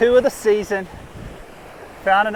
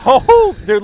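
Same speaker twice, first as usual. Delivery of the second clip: high laughing burst; excited laugh; 6 semitones higher, 8 dB louder than usual. Real, not acted. Laughing at fish.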